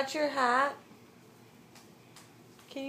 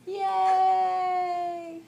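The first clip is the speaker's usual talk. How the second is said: excited cheer; 5 semitones higher, 3 dB louder than usual. Real, not acted. Cheering baby on.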